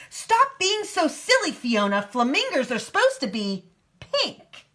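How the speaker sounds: high-pitched telling-off, easing toward the end; scolding squawk. A narrator's reading. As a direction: scold her like an exasperated flamingo. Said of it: "squawking and yelling at her".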